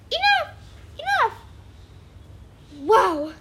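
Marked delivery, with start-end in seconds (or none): 0.1-1.3 character shouting to stop the song; acted outburst. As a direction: cut them off sharply.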